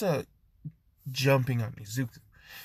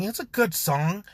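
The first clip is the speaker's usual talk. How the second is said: defensive protest; 7 semitones higher than usual. Acted. Defending her song against mockery.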